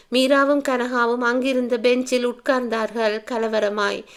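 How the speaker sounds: usual speaking voice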